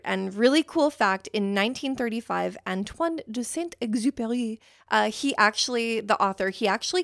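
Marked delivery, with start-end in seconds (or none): 2.7-4.6 French-accented name, slightly raised pitch. Shown as a demonstration. Podcaster naming the French author, attempted French accent. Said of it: "I'm just butchering his name".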